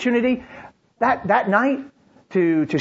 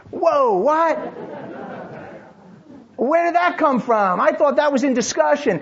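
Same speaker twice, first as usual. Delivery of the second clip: higher, startled "whoa, what?"; caught off guard; 7 semitones higher than usual. Retold or imitated. Father hearing about the phone, startled and higher. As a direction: thrown by news he never agreed to. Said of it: "or he's like".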